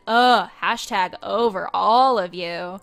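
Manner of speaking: loud, drawn-out eye-roll; fed-up ghost girl dismissing the dinner guests; annoyed teen. Retold or imitated. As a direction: sigh it out like you're over everyone. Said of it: "And I was like".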